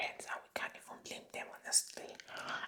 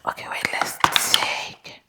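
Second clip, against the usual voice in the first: Doctor Quackity Quack; 7 dB louder than usual. Acted. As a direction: play an overeager fake doctor, still whispering. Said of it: "I'm Doctor Quackity Quack".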